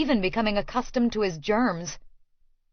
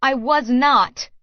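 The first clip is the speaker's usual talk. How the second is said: indignant; 4 semitones higher, 6 dB louder, slower than usual. Acted. Heated quarrel in car.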